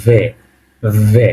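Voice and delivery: ভে, v sound with e, not English বি; Russian ve. Shown as a demonstration. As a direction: say ভে as Russian В, slowly repeated. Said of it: "উচ্চারণটা ঠিক আমি যেভাবে বলতেছি".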